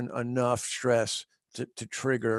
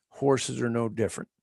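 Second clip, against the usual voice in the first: ordinary delivery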